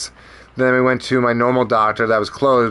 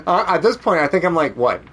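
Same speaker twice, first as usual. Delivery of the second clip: ordinary talk, nothing marked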